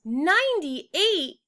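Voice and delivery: high, slow thrilled cry; excited exclaim. Acted. Judy thrilled by the tree count, slow high exclaim. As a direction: gasp it out in delight.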